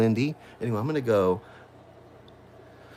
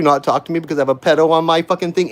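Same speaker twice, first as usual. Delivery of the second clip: ordinary talk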